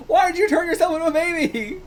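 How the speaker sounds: very high, incredulous outcry; exasperated disbelief. Real, not acted. Reader asking why he became a baby, incredulous squeal. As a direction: repeat it squeaky with bewilderment. Repeats question, much higher.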